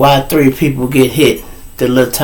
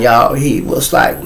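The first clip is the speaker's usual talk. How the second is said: normal talking voice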